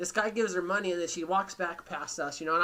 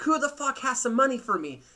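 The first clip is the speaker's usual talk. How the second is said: rude lady yelling; 6 semitones higher, 5 dB louder than usual. Retold or imitated. Retells rude lady yelling on bus.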